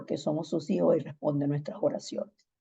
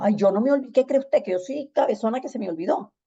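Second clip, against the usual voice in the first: mock-indignant retort, pitched up; indignant misreading; 6 semitones higher, faster than usual. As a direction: read the message as if it were an accusation. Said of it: "yo lo tomé como que usted me dijo".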